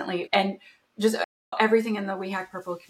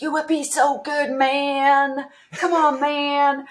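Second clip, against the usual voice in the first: higher, louder put-on dude voice, stretching the last "man"; pushy bandmate; 7 semitones higher, 5 dB louder, much slower than usual. Pen tester friend coaxing her to switch careers, in a put-on dude voice. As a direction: egg her on like a laid-back buddy. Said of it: "That doesn't sound like a Canadian accent".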